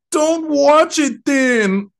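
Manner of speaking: high, drawn-out mocking whine of a baby; exaggerated crybaby complaint. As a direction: imitate whining babies, slow and high. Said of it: "Sounded like fucking babies".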